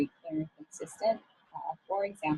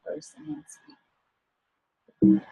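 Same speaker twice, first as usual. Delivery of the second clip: a hushed, drawn-out final word of advice; slow emphatic whisper; 10 semitones higher, 6 dB louder, much slower than usual. Real, not acted. Career coach drives home her tip to listeners in a slow whisper. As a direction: whisper it, very slowly, each word apart. Advice on thinking before speaking.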